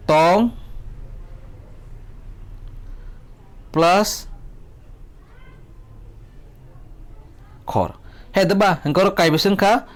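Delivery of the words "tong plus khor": "Tong", long pause, "plus", long pause, "khor"; syllable by syllable. Shown as a demonstration. Teacher counting two syllables for students, "Tong plus khor" spaced far apart. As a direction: leave big gaps around "plus", each part on its own. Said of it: "kainui, kokthor wngkha".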